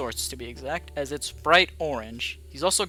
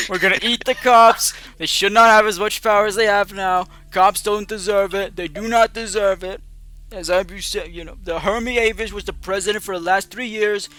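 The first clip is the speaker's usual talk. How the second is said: higher, loud bragging campaign speech; pompous politician voice; 6 semitones higher, 8 dB louder than usual. Acted. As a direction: boom it out like a demagogue at a rally.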